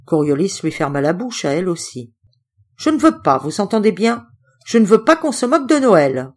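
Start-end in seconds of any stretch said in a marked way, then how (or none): 2.8-6.3 loud, high scolding outburst; an uncle who won't have his guest mocked; angry uncle. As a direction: scold them hard, voice up.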